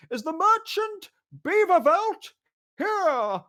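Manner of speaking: high, slow crowing call; crowing announcement. Acted. Official calls from doorway.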